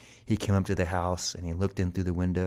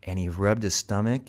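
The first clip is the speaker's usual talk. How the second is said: usual speaking voice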